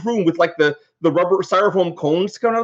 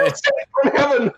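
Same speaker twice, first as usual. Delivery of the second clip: delighted exclamation; 7 semitones higher than usual. Retold or imitated. Grateful developer quoting his reaction.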